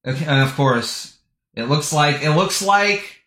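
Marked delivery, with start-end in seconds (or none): none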